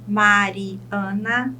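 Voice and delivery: slow "Mariana", broken into its syllables. Shown as a demonstration. Teacher shows a polysyllable to students, "Mariana" syllable by syllable. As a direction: draw out and separate the syllables of "Mariana". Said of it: "eu chamo de polissílaba".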